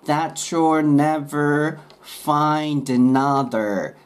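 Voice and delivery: slow linked "That you'll never find another", T and Y blended into chu; linked slow demo. Shown as a demonstration. Teacher slowly modelling linked pronunciation for learners. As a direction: stretch each word, glide "find another" together. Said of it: "くっついて".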